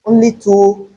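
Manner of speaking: ordinary talk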